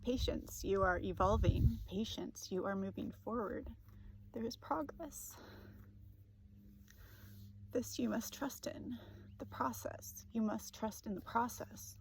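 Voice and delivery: whispered tearful reading, breaking in places; crying while reading. Real, not acted. Reading the guide's words to viewers through tears. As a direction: push gently through the tears.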